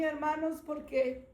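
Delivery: ordinary talk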